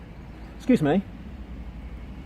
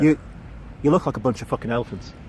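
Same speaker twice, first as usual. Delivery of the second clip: put-on Englishman trying an Irish accent; lower, quick go at an Irish accent; attempted Irish accent; 3 semitones lower, 3 dB quieter, faster than usual. Auditioner trying a film line on his Irish helper, attempted Irish accent. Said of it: "sound like an Englishman trying to do".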